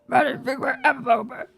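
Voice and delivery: growly, grumpy puppet voice; mock them in a grouchy growl; Oscar the Grouch. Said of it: "You sound like Oscar the Grouch".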